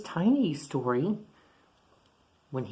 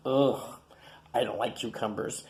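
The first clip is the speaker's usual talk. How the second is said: usual speaking voice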